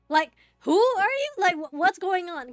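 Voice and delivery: startled confrontation. Retold or imitated. Confronting tow dudes, retold panic.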